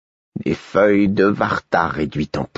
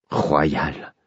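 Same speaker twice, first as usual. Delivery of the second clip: low, soft growl of anger; 3 semitones lower, 4 dB quieter than usual. A narrator's reading. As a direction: growl the name through the teeth. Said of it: "grondai-je".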